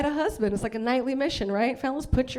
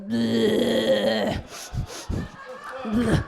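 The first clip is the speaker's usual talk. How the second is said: caveman grunt. Retold or imitated. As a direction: grunt it like a hunched brute. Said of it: "He's hunched forward like".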